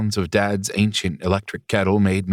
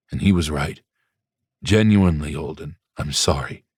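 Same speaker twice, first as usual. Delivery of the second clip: a lightly voiced ailing father, low and soothing; gentle old father; 3 semitones lower than usual. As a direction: speak as a sick but tender dad comforting his son. Pops apologises to son.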